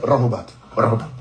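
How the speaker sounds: lightly voiced elders saying "Rahubat"; mock elder Rahubat; imitating elders. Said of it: "they like".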